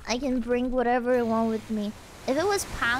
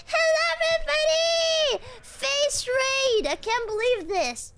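high, thrilled shouted greeting; excited shout; 10 semitones higher than usual. Real, not acted. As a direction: welcome a surprise crowd, overjoyed. Greets surprise raid.

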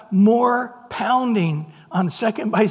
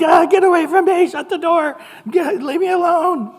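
put-on much higher and louder, a teen brushing off mom; annoyed teenager; 10 semitones higher, 6 dB louder than usual. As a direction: push her away like a fed-up thirteen-year-old. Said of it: "And they're like".

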